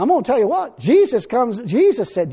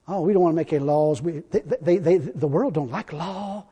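lower, much softer, mincing mockery of timid churchgoers; mocking soft whine; 6 semitones lower, 8 dB quieter than usual. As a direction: sneer at them in a meek little voice. Mocking soft anti-law churches.

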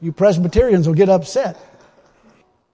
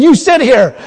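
louder, higher acted command; forceful order; 5 semitones higher, 7 dB louder than usual. Preacher retells Wigglesworth ordering his driver, forceful command. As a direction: give a firm, raised-voice order.

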